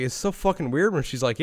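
ordinary talk

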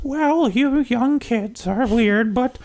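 put-on high, slow old lady voice; a sweet old granny saying yes. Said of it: "Why does that sound like your Winnie the Pooh voice?".

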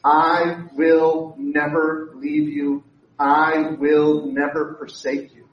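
slow emphatic promise. Shown as a demonstration. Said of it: "as the Lord our God says".

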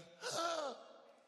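sharp, high gasp for air. Retold or imitated. Vicar imitating a child who cannot swim, a high gasp. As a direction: gulp air like a sinking child.

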